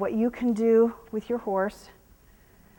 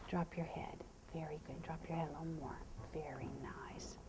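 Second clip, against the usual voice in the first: very soft hushed whisper; 7 semitones lower, 21 dB quieter than usual. Real, not acted. Trainer whispering so the horse lowers his head. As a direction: whisper low to bring the head down.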